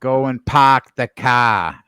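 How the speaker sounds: put-on slow Boston accent, R dropped in park and car. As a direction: drawl it like a Bostonian, no R. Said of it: "pronounce an R that comes after an A".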